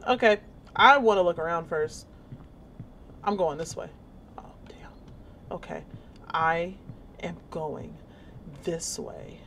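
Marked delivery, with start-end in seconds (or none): 4.4-4.9 breathy under-the-breath curse; whispered oh damn. Real, not acted. Player startled by the game, whispering a curse. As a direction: whisper it to yourself, caught off guard. Startled aside while playing.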